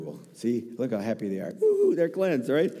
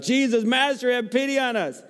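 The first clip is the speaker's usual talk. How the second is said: pleading cry; 10 semitones higher than usual. Acted. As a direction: cry out to be heard from afar. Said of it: "they shouted".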